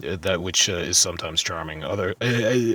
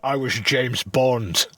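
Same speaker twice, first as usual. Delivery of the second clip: Connery impression; 4 semitones higher, much slower than usual. Connery impression after laughter.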